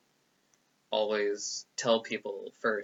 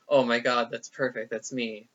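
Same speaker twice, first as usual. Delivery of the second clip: excited discovery; 8 dB louder than usual. Retold or imitated. Discovering the word asexual.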